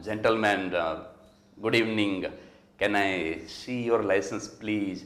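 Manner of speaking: soft, very slow, courteous; gentle polite police request; polite American cop. Retold or imitated. Polite American cop greeting a stopped driver, soft and slow. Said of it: "એટલું બધું આમ વિવેકથી બોલે".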